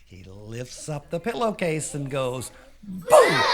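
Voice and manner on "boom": sudden high, loud burst; explosive spooky reveal; scare shout. Acted. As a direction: shout to make them jump. Lance reveals scary face.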